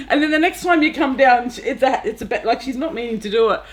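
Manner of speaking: ordinary delivery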